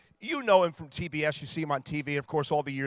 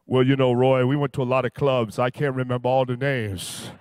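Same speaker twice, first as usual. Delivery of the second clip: lower, louder big-man voice; Shaq voice; 5 semitones lower, 6 dB louder than usual. Retold or imitated. Shaq telling Roy how upset he is, in a low booming voice. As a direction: put on a deep, booming Shaq.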